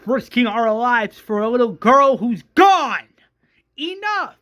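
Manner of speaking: high, loud, furious rant; screamed tirade. Acted. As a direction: scream a rallying demand at the group. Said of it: "that's where Shane screams".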